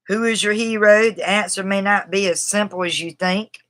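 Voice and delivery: ordinary talk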